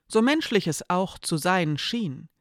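usual speaking voice

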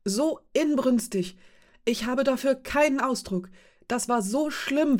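höher, lauter und langsam, voller Inbrunst; inbrünstige Empörung. A narrator's reading. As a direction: jedes Wort empört auskosten. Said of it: "rief Mara aus". (Mara empört sich langsam und inbrünstig über die Zarin)

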